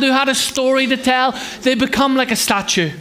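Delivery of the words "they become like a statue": ordinary talk, nothing marked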